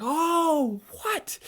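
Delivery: can't believe the reveal; reacting to the big twist in shock; stunned disbelief.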